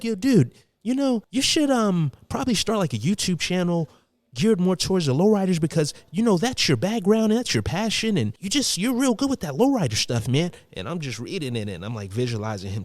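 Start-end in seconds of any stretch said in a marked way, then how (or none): 0.0-10.5 high-pitched, earnest dude impression; imagined friend's voice. Reading friend's email about lowriders in his imagined high voice. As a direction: imitate a keen buddy, pitched way up. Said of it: "'cause this is how it sounded".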